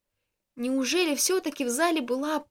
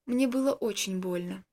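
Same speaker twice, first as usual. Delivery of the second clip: ordinary delivery, nothing marked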